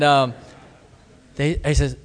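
ordinary delivery

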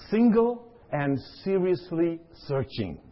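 slow, each word weighted: "Single and seriously searching"; spelling out triple S. Shown as a demonstration. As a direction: unpack the acronym slowly, leaning on each S word. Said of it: "I wonder if anybody knows what that is".

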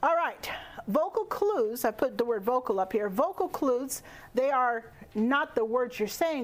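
normal talking voice

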